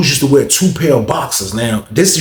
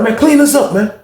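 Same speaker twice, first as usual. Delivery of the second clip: scolding order; 10 semitones higher than usual. Retold or imitated. Inmate scolds cellmate.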